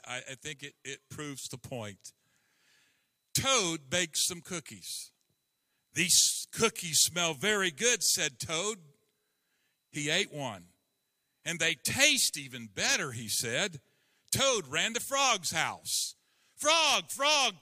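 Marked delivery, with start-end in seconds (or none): 3.3-16.1 lively children's story read aloud, characters lightly voiced. Reads Frog and Toad story. 16.6-17.6 higher, louder cry for his friend; calling out. A narrator's reading. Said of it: "cried Toad".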